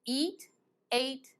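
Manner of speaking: usual speaking voice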